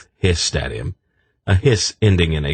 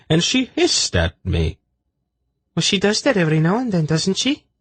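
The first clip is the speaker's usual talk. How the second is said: much higher, lighter voice; high female character voices; 11 semitones higher than usual. A narrator's reading. Women worrying over the snake, high light voice.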